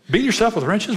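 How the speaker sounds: high-pitched retold disbelief; incredulous. Pastor querying a man who hits himself with wrenches, incredulous. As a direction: echo it back as an astonished question.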